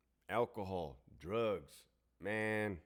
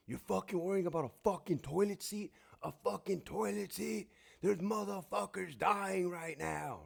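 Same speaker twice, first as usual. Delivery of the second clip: high, strained, slowed-down yell of disbelief; enraged yelling; 10 semitones higher than usual. Retold or imitated. Yelling back at the wife over a toilet seat, enraged. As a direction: rage at a petty complaint, voice pitched way up. His retold rage at the wife.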